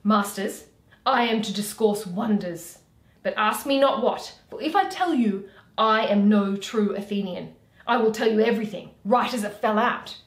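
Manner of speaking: loud swaggering Bottom. Acted. Bottom teasing the players with his tale, loud. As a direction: boast to the players loudly.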